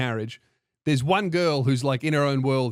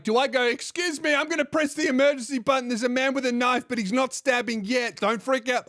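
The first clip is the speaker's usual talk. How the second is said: high-pitched, hammed-up emergency announcement; mock announcement; 9 semitones higher than usual. Announcing to passengers before pressing the emergency button, mock alarm. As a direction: pitch it up, pretend to pre-warn everyone.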